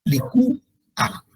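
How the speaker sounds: "Licúa" slow, li-CÚ, pause, a; hiatus demo. Shown as a demonstration. Teacher showing vowels in separate syllables, slow split "Licúa". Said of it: "se pronuncian como".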